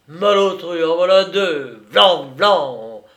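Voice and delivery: loud, punchy retort; indignant outburst. A narrator's reading. Man returning a slap to his friend, loud and indignant. Quarrel turns to slaps.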